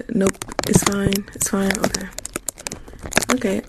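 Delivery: ordinary delivery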